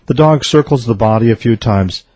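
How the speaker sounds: normal talking voice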